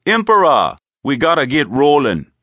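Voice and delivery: high, loud call to the boss; high-voiced henchman hailing his leader. Henchman calls in from the door.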